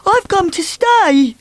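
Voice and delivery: high, slow, cheerful; muddled Mister Muddle. Acted. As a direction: announce it happily.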